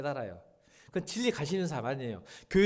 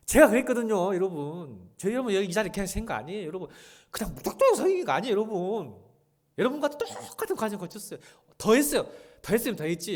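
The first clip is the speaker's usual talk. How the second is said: higher, louder pleading sermon; fervent personal testimony; impassioned preaching; 7 semitones higher, 8 dB louder than usual. Acted. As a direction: testify with rising heat. Preaching at full force.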